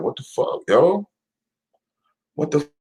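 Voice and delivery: high, hushed bafflement, breaking off; retold disbelief. Streamer sums up six months of turmoil, in high baffled disbelief.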